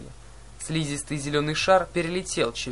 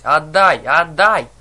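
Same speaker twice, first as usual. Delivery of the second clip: loud, insistent cries; yelling demand; 11 dB louder, much slower than usual. A narrator's reading. Enraged sister demanding her tooth back, yelling. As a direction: yell it out twice, much louder. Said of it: "не переставая вопить".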